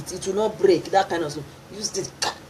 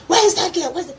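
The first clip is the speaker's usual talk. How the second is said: high, loud angry call; a father shouting for the girl; 11 semitones higher, 3 dB louder, faster than usual. Retold or imitated.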